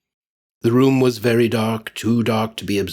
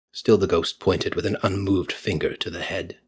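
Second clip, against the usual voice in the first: ordinary delivery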